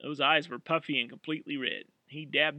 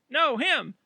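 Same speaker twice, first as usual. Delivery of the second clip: urgent call; 11 semitones higher, 8 dB louder than usual. Acted. Amy correcting Josie urgently.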